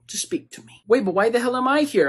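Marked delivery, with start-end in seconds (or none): 0.1-0.8 whisper. Acted. Therapist drops voice.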